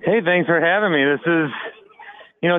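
usual speaking voice

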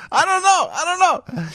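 high, hammed-up accented I don't know; mock foreign accent. Host slipping back into the call-centre accent, mock foreign voice.

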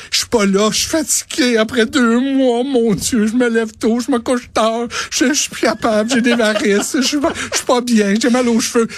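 high, whining complainer voice; mock whiny complaint. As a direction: whine it like a grumbler. Mock whining about being tired.

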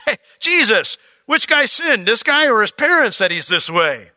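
high, loud nosy disciple voice; preacher mocking a gossiping disciple, high and loud. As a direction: ham up a busybody quizzing Jesus. Mocking the disciples asking Jesus.

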